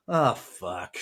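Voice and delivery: exasperated groan. Retold or imitated. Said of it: "rolling our eyes going".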